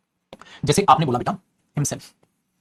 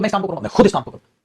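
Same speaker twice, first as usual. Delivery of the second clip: first half even, then खुद punched in the repeat; stress on खुद; 3 semitones higher, faster than usual. Shown as a demonstration. Teacher contrasting plain and emphatic sentences: खुद stressed. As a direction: say it once flat, then hit खुद. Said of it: "आप ये बोलो".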